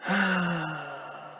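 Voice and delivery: long high drawn-out sigh; demo sigh. Shown as a demonstration. Teacher showing the class what sighing sounds like. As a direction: show the class a heavy sigh. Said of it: "sighing is going like this".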